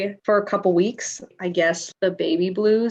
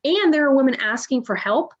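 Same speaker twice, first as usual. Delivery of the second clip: ordinary talk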